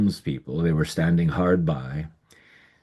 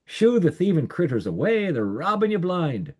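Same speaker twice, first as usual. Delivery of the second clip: neighbors calling out; 10 semitones higher than usual. A narrator's reading. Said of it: "they'd cry out".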